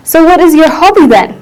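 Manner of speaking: ordinary talk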